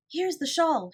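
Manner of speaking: high, loud discovery; delighted cry. A narrator's reading. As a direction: pounce on the find. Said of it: "she cried".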